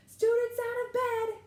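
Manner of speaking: very high, loud slow bellow. A narrator's reading. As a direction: bellow it down the halls. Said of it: "Peeves bellowed".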